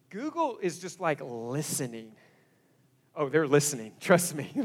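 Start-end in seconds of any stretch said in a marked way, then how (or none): none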